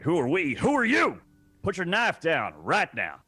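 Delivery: loud, high Southern threat, slowing on the last line; Southern accent. Acted. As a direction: bark it in a Southern drawl at the knife guy. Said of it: "Southern blanket accent".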